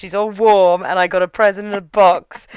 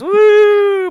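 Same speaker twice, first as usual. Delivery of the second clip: high, louder cheer held out long; excited whoop; 14 semitones higher, 6 dB louder than usual. Real, not acted. Hyping her parasite hand on the podcast, loud high whoop. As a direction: cheer like the crowd just went wild.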